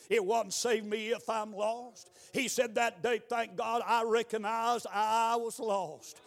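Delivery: ordinary delivery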